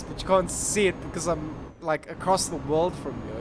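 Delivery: ordinary talk, nothing marked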